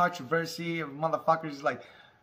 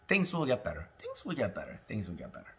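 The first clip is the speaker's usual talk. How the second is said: fading reassurance; 8 semitones lower, 10 dB quieter, faster than usual. Real, not acted.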